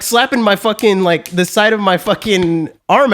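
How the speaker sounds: ordinary talk, nothing marked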